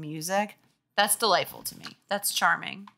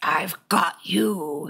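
gruff monster voice; much slower than usual. Acted. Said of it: "she screamed".

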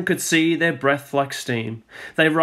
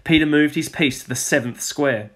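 ordinary delivery

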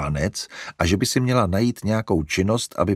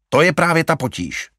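louder, higher annoyed; Larry working himself up; fuming; 8 semitones higher, 6 dB louder than usual. A narrator's reading. Larry ranting at mother about animals, fuming. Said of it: "čílil se Larry".

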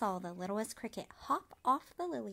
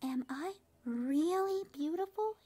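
hopeful question; 7 semitones higher, 5 dB louder than usual. A narrator's reading. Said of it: "he asked at last".

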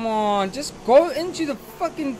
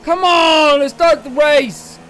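louder, higher urging call; impatient shout; 4 semitones higher, 10 dB louder, slower than usual. Real, not acted. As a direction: push the race to restart. Impatient behind safety car.

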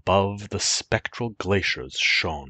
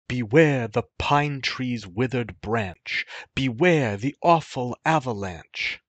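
higher, louder urgent warning; peasant's warning; 7 semitones higher, 4 dB louder than usual. Acted. The peasant warning the youth of the avalanche, urgent. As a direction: call out a last caution. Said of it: "This was the peasant's last good night".

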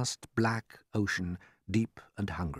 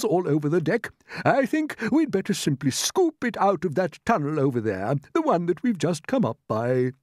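raised, loud, stately character voice; cheered old grasshopper; 9 semitones higher, 6 dB louder than usual. Acted. Grasshopper proposes scooping food from the tunnel, brisk approval. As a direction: take charge as a pleased old grasshopper.

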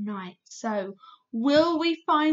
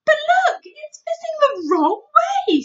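put-on high, loud alarmed girl; surprised little girl cry; Sophie crying out; 11 dB louder than usual. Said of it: "Sophie cried".

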